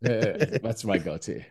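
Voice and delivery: chuckling through the words, low and soft; amused low chuckle while talking; laughing. Real, not acted. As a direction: laugh it out as you repeat it. Repeating his pick amid laughter.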